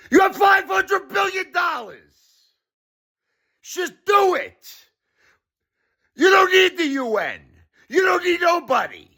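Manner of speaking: very high, strained yelling; exasperated shouting. Real, not acted. Telling the billionaire to donate without the UN, exasperated shout. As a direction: yell each demand at the top of the voice.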